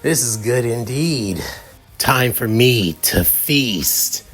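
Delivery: slow, menacing creature voice with a chuckle; slime monster. Acted. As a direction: savour it like a hungry monster. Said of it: "the figure looking at Izuku saying".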